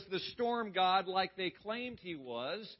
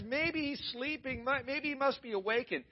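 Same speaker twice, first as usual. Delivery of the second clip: mocking taunt; 7 semitones higher than usual. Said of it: "He's talking trash".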